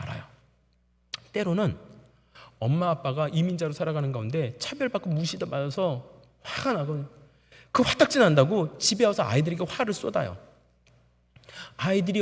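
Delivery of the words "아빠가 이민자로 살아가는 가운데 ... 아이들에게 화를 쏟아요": ordinary talk, nothing marked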